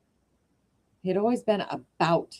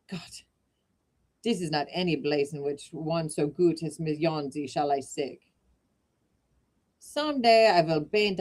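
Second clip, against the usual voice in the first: lightly voiced German accent, gruff then softening. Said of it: "sorry about the accent".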